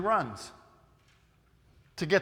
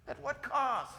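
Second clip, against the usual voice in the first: much softer repeat, cost falling away; soft echo; 5 semitones higher, 9 dB quieter, slower than usual. Acted. Preacher echoing his question to students, hushed. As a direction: drop to a near hush on cost. Repeated softly after loud take.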